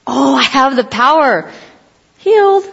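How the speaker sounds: thrilled realization, jumping up on healed; mock boyish delight; excited discovery. Boy Jesus discovering his power.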